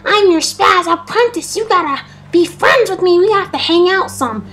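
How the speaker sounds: high put-on voice calling out; mock pleading chase. Kid chasing his creeped-out friend, high needy mock call. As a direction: imitate an overeager kid calling after someone.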